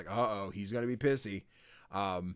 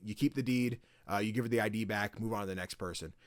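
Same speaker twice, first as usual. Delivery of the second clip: ordinary talk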